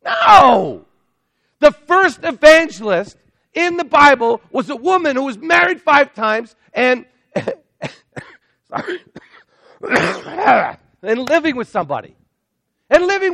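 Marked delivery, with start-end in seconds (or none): 0.0-0.8 a loud, sharp, high "No!"; emphatic shout. Acted. Pastor rejecting his own mock. 1.6-12.2 forceful preaching. Real, not acted. Pastor making his main point.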